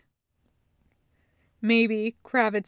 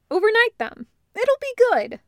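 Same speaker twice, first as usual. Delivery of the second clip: excited; 12 semitones higher, 6 dB louder than usual. A narrator's reading. Barry thrilled about gift idea.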